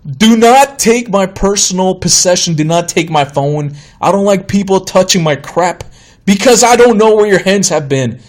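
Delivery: retold angry warning, rising high in bursts; pissed off. Customer objecting as manager takes the phone, pissed off. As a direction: act out indignant protest. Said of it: "now I'm pissed off".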